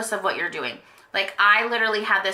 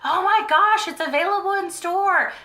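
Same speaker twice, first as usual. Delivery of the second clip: high-pitched excited gasping exclamation, retold; shocked delight; 10 semitones higher, 3 dB louder than usual. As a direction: relive the thrilled discovery, pitched way up. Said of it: "I was so shocked".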